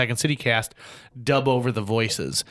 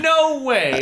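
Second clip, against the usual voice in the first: much higher, louder burst of disbelief; amazed disbelief; 13 semitones higher, 5 dB louder than usual. Retold or imitated. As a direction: blurt it out, thrilled. Stunned reaction to coincidence.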